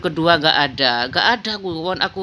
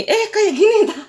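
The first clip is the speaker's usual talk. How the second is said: laughing squeal; 5 dB louder than usual. Real, not acted. Fumbling fan, laughing squeal.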